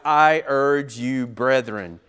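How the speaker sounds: slow, loud and weighty urge; firm appeal. Shown as a demonstration. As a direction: press the words urge you slowly and loudly. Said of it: "It's a very strong word".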